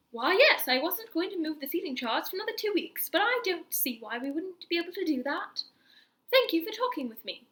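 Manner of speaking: a pleasant teacher, lightly put-on British; British accent. Teacher granting the seat change, in a light British accent. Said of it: "I don't know why she's British".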